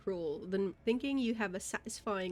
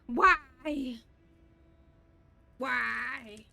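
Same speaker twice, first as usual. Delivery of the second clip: exasperated cry; 4 dB louder than usual. Real, not acted. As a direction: cry out the word why. Rising exasperated repeat.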